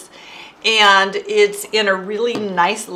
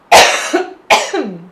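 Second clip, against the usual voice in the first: coughing fit; 3 semitones higher, 7 dB louder than usual. Real, not acted. Choking on saliva mid-livestream.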